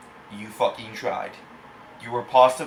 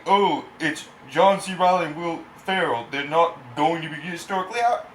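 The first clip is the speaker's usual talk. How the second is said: higher, sneering imitation of a defensive fan; mocking fan voice; 5 semitones higher, 3 dB louder than usual. Reviewer mocks defenders of the movie, sneering imitation. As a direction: parrot the fans' excuse with contempt. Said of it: "and I know you're fucking".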